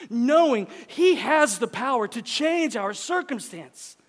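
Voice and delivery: high, loud sermon cry, words stretched; full-force preaching. Acted. Preacher pressing the congregation about anger at God, full-force preaching.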